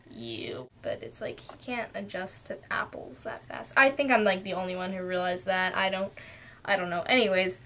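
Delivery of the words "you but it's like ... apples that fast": hushed aside. Real, not acted.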